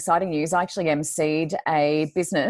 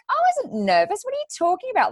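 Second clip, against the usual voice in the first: much higher, squeaky mock protest; indignant denial. Retelling how she answered her husband, high-pitched and indignant. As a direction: play up your outrage in a high squeal.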